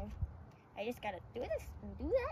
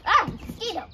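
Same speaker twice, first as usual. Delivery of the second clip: startled yelp; 8 semitones higher, 8 dB louder than usual. Real, not acted. Startled by mosquito.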